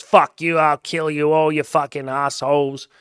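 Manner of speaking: loud, high mock drunk yelling; drunk stranger screaming. Drunk man on the train screaming obscenities at everyone, loud angry yell. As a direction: bellow it like a raging drunk on a train. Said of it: "drunk on the train screaming obscenities".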